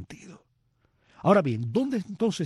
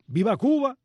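higher, louder cry of Viva Cuba; retold shout; 9 semitones higher, 4 dB louder than usual. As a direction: call it out like a patriot in the theatre. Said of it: "una persona gritó". (grito exaltado de una persona del público)

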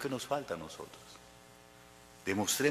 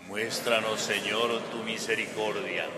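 congregation response; 3 dB louder than usual. Real, not acted. Assembly answers the prayer together.

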